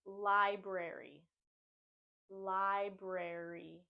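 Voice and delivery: library slowly as LIE-brair-ee; "library" drawn out with two R's; both R's pronounced. Shown as a demonstration. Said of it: "we're pronouncing both R's".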